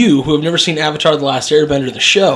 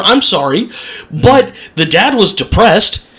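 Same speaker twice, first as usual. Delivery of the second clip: mock angry rant; 7 semitones higher than usual. Ranting about the movie.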